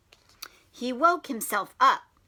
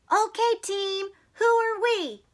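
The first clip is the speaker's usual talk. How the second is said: higher, bright pep call; rallying coach; 8 semitones higher than usual. Acted. Max's mother rallying the team, high pep call. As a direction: pump up the team.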